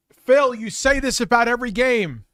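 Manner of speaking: a big Kramer-style entrance yell; Kramer-style outburst. Pretend. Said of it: "Kramer through one of his doors".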